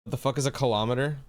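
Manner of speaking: ordinary talk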